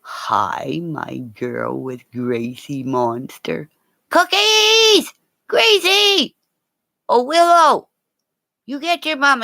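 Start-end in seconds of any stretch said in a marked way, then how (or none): 4.1-9.4 high, loud call across the house; calling the dogs. Real, not acted. Calling her dogs Gracie and Willow over, loud high call. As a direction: shout for the dogs to come.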